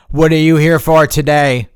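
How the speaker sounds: imitated loud front-desk greeting; brusque receptionist impression. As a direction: demand it like an impatient receptionist. Imitates unhelpful front-desk worker.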